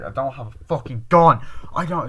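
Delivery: loud mock-exasperated denial; emphatic denial. Accused girl denying the gun, loud and emphatic. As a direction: insist on it, acting out the quarrel.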